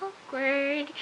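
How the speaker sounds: high, drawn-out singsong aside; mock awkward. Viewer reacting to lipstick remark, singsong cringe. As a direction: sing out the cringe in two notes.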